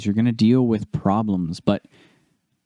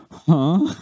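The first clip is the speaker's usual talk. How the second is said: stunned huh; 6 semitones higher than usual. Retold or imitated. Stunned by the compliment.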